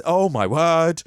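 ordinary talk, nothing marked

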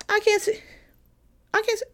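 high squeaky baffled whine; mock confusion. Student in chemistry mocking confusion, high-pitched. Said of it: "I'm like, An atom?".

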